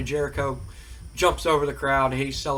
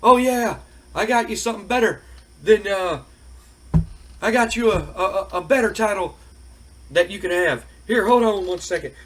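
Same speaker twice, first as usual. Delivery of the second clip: higher, louder taunting; mocking Stone Cold; 5 semitones higher, 4 dB louder than usual. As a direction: tease him loudly like offering a gift. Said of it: "And Stone Cold goes".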